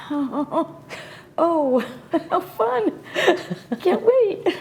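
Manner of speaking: high, drawn-out sarcastic delight; oh joy, said with dread; mock enthusiasm. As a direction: feign excitement about something you dread, slowly. Mock enthusiasm at dreaded blind date.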